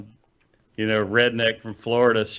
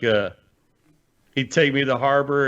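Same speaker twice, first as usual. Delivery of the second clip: ordinary talk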